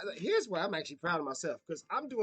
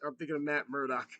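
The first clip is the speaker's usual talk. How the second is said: usual speaking voice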